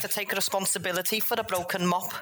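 high, overly polite apology; apologetic Jeff. Acted. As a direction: grovel politely.

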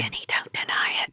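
soft whispered aside. Acted. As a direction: whisper it firmly. Cuts Denny off quietly.

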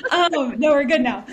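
laughing fit while recovering; can't stop laughing. Real, not acted. Laughing after choking fit.

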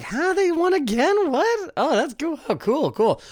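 hammed-up confused fan; mock surprise. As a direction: act baffled then shrug it off. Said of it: "It's more like".

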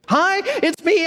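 the pastor plays himself fussing at the house sitter, chirpy; fussy phone caller. Retold or imitated. A bright, over-eager caller checking in too soon. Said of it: "I say".

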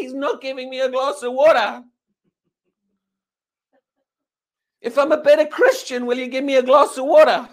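high, loud mock whining plea; hammed-up desperation, pitched well up; desperate begging. Acted believer bargaining with God for water, hammed-up desperate pleading.

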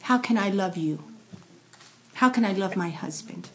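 ordinary delivery

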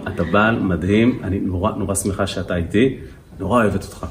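tender praise. Acted. Wife tells husband she's glad he's with her, tenderly. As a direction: linger gently on the compliment, acting it. Same loving praise acted once more.

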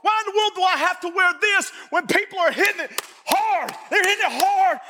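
pastor pressing the congregation on hardship, impassioned preaching; fervent preaching. Acted. Preaching at full force.

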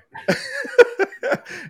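a high squealing laugh; high burst of laughter. Real, not acted. Laughing at himself over breaking his thumb, high squealing laugh. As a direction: crack up high and loud.

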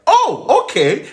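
a sudden high, loud oh; pleasantly surprised. Retold or imitated. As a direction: squeal it out, won over. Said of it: "I was like".

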